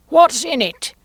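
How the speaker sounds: loud, high demanding queen; sharp queen. A narrator's reading.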